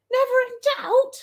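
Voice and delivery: very high, quick squeak of disbelief; incredulous echo. Real, not acted. Echoes the comment, having doubted herself.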